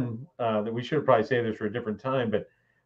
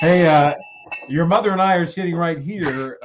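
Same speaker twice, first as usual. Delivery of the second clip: raised, loud fatherly warning; stern dad warning; 6 semitones higher, 7 dB louder than usual. Retold or imitated. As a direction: call them out like an embarrassed dad. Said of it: "I was like".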